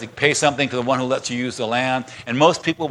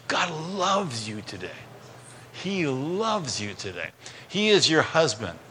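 tender assurance; 7 dB quieter, slower than usual. Real, not acted. Pastor tenderly assuring congregation.

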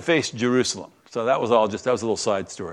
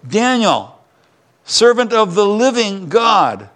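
higher, slow anguished calling out of a name; 7 semitones higher, 4 dB louder, slower than usual. A narrator's reading. King calls down into the den, anguished and drawn out. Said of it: "he called to Daniel in an anguished voice".

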